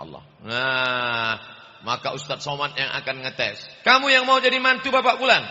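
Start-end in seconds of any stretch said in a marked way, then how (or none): 3.9-5.5 high, loud, fast questioning examiner; stern examiner. Acted. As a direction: grill the young man from on high. Examiner questions prospective son-in-law.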